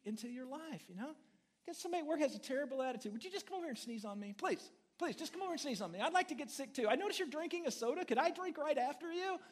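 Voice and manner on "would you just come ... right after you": higher, fast, hammed-up begging; preacher mocking a coworker-pleader, hammed-up begging; mock pleading. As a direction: beg sarcastically to be sneezed on.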